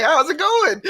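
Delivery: high, laughing imitation of his wife; wife asking. Husband imitating his wife greeting him, a laughing high wife voice.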